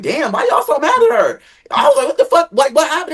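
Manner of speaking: high, startled disbelief at their anger; mock shock, voice jumping up; baffled surprise. Baffled asking the group why they hate Keisha, high surprise.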